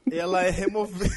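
words through giggles; laughing while talking. Real, not acted. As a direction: try to talk through laughter.

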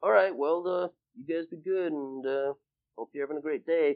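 lightly voiced dad addressing the kids; imitating his dad. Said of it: "And he's like".

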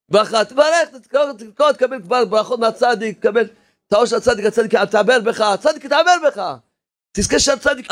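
ordinary delivery

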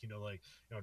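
normal talking voice

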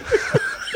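squealing airy laugh; a high wheezing laugh; helpless high laugh; high breathless laugh. Real, not acted. Laughing at the scene.

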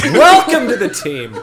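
high, loud, stretched-out mock glee; hammed-up, slow triumphant welcome; gleeful welcome. Itachi recruits Pain to the team, gleeful.

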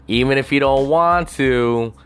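ordinary talk

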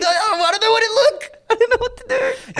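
mock panicked laugh-cry. Real, not acted. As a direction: laugh helplessly as you say it.

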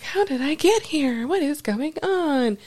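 high, bewildered questions; confused. Retold or imitated. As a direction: wake up dazed and lost. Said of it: "she's like".

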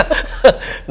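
loud, high burst of laughter; amused laugh. Real, not acted. Husband tickled by his tough wife, amused laugh. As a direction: laugh it out freely.

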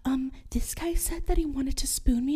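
unvoiced secretive whisper. Girl whispering to her friend Jamie.

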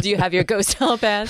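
usual speaking voice

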